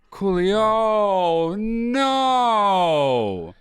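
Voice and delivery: loud, high, drawn-out mock lament; mock wail. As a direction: wail it in hammed-up grief. Mock mourning of [name]'s death.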